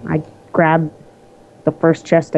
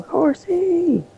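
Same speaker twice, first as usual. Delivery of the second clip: high squeal; 12 semitones higher, 4 dB quieter than usual. Pretend. Echoing the joke.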